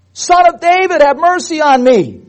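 loud, very high cry for mercy. Acted. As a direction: wail it out at the top of the voice. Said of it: "But he cried all the more".

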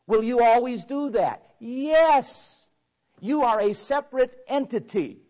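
loud, high call and answer with the congregation; preacher rousing his brethren; preaching at full force. Real, not acted. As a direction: hammer each yes home.

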